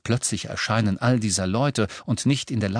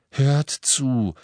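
mildly urgent, higher plea; growing panic; 5 semitones higher than usual. A narrator's reading. Harry tries to stop his friends, growing panic. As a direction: demand attention with a touch of panic. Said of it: "sagte Harry mit wachsender Panik".

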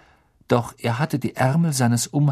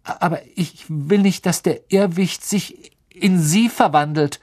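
put-on higher, anxious boy's voice; nervous Neville; 8 semitones higher, 4 dB louder than usual. A narrator's reading. Neville anxiously tells the teacher he fears his grandmother, higher nervous voice. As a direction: hurry it out, worried. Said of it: "sagte Neville nervös".

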